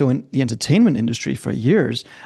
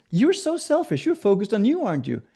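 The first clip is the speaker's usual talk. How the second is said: lightly voiced mentor, higher scolding; mock-stern telling off, pitched up; 8 semitones higher than usual. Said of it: "And he goes".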